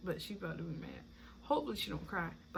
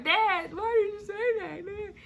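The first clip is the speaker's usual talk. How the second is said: nurses scolding; 11 semitones higher, 3 dB louder than usual. Retold or imitated. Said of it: "they was like".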